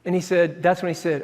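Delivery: usual speaking voice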